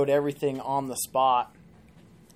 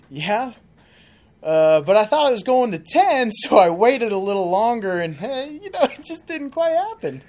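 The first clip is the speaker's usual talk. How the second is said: high, loud, whiny farmer voice climbing ever higher; farmer's sheepish excuse; 8 semitones higher, 10 dB louder than usual. Acted. Farmer explaining to the genie why he wished again, high whiny plea. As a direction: plead it like a caught-out farmer, pitch rising. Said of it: "He's like".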